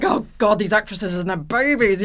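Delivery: playing a harried assistant director rolling his eyes; a hint of a fed-up crew boss grumbling; exasperated first AD. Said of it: "the first is freaking out".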